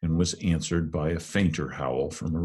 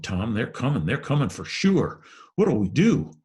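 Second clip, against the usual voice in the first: frightened; 6 semitones higher, 5 dB louder than usual. Acted. Huck panicking at approaching figures.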